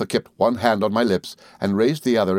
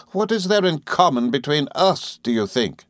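higher, scornful challenge; fierce question, dropping at the end; Rosa Dartle, fierce; 6 semitones higher than usual. A narrator's reading. Rosa disowning Emily, fierce. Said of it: "returned the other fiercely".

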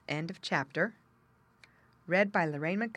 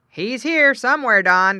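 loud, raised-voice call; farmer calling his dog; 6 semitones higher, 13 dB louder than usual. A narrator's reading. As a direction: call out to the dog across the cornfield.

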